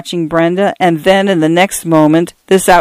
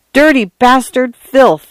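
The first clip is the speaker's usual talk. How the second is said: high-pitched outburst of disgust; narrated cry of rage, held short of a shout; angry cry; 7 semitones higher, slower than usual. A narrator's reading. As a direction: spit out insults between blows. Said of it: "Arthur cried".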